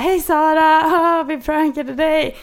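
high, loud prank reveal; pranksters' shouted singsong, imitated; imitated pranksters. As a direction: call out the reveal, slow and high. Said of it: "högtalare och bara".

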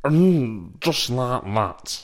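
slow half-voiced catchphrase; a hint of Tommy Cooper's drawl; Tommy Cooper impression. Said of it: "Gary Hooper or Tommy Cooper?".

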